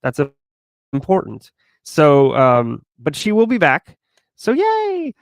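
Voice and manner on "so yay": high, happy yay; excited cheer. Real, not acted. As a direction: squeal a little yay.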